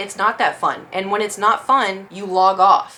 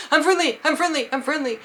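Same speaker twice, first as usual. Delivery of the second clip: higher, hurried plea of I'm friendly; a higher, mock-panicked I'm friendly; 7 semitones higher than usual. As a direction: plead fast so they won't shoot. Panicking in front of guns.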